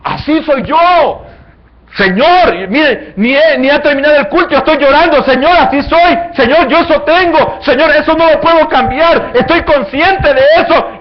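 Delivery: high, loud anguished pleading to the Lord; pleading sinner. Acted. Believer who sees his sin crying out to the Lord, high and loud. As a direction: cry out to God as a broken sinner. Acts a weeping believer before God.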